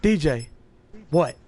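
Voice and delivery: lightly voiced drunk brother getting sentimental, then snapping; drunk goodnights. Drunk Jordan bidding each friend goodnight before passing out, mock-sentimental.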